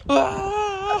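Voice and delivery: very high mock wail; high-pitched pretend sob; imitated crying wail. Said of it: "he starts crying".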